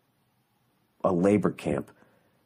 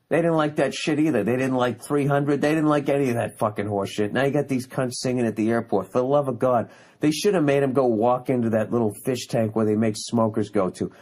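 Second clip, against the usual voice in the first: ordinary talk, nothing marked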